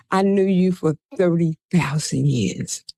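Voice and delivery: hushed whisper, no voice. Retold or imitated. Riley whispering an ancient secret to her, hushed whisper. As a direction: breathe it like a secret. Man's secret words retold.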